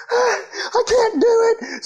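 high, slow, wailing sob; crying whine. Retold or imitated. To dad on the mountain, a slow high crying whine. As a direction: whimper like a worn-out kid who can't go on.

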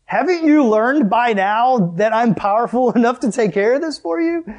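Jesus scolding. Acted. Jesus to disciples who doubt his power, loud exasperated scolding. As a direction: scold in loud, high-pitched disbelief. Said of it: "So Jesus kinda scolds them".

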